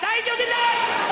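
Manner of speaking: high, drawn-out vendor's call; long high sing-out to the crowd; confident usher. Retold or imitated. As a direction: call it out slowly like a theatre usher selling puddings. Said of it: "It's a very confident usher".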